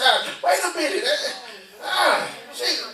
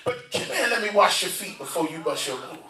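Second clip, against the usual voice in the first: hushed, lower, almost whispered; soft invitation; 7 semitones lower, 6 dB quieter than usual. Retold or imitated. Jesus quietly inviting Judas to have his feet washed, hushed. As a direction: draw him in quietly.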